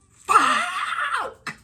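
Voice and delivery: high, loud shouted curse; shocked curse. Retold or imitated. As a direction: blurt it out in alarm. Seeing the neighbour outside.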